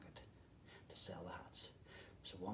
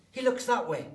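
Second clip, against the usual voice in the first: searching; 11 semitones higher, 14 dB louder than usual. A narrator's reading. Searching for hats.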